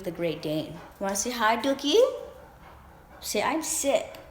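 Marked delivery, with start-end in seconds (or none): 0.7-3.4 pet talk. Real, not acted. Talking to her dog. 3.4-4.2 voicing the dog.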